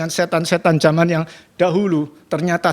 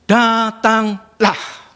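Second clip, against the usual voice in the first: very slow, higher drawn-out call; calm invitation; 5 semitones higher, much slower than usual. Retold or imitated. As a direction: stretch it out tenderly. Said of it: "Sebuah firman". (ajakan lembut yang sangat lambat)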